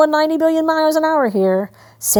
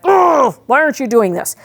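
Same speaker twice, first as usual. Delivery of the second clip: imitating a fed-up owner's shout; mock exasperated yell at the dog; frustrated owner. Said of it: "people get frustrated and they're like".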